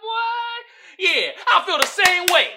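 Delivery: high, loud, amused outburst; delighted agreement. Real, not acted. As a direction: shout agreement fresh off a laugh. Delighted agreement after laughing.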